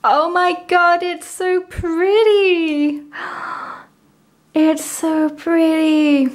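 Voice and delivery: high, slow, drawn-out delight with a gasp; overjoyed, stretching out the words; thrilled gushing. Real, not acted. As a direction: swoon over it in real awe. Thrilled reaction on opening album.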